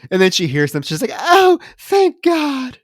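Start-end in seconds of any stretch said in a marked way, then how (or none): 1.1-2.8 high, loud, drawn-out relief; mock relief. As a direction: play her gushing relief, hammed-up. Acted relief of screaming character.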